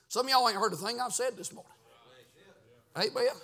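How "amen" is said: usual speaking voice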